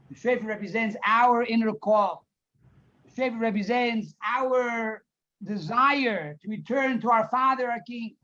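ordinary talk

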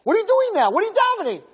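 very high-pitched scolding; high, incredulous mock yell; imitated yelling. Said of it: "Hashem's screaming at him, he's yelling at him".

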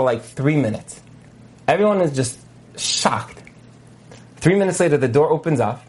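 usual speaking voice

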